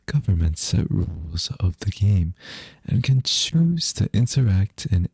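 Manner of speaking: loud, projected anime narrator voice; VTuber anime voice. Shown as a demonstration. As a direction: announce it like an anime hero. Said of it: "I'm pretty good at this voice acting stuff".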